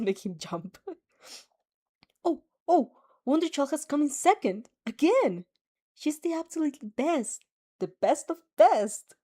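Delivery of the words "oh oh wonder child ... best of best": higher, louder proud cheer; excited cheer. Acted. A parent cheering her daughter on TV, excited. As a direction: gush over your daughter's win.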